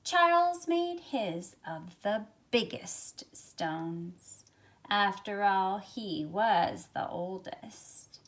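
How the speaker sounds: ordinary delivery, nothing marked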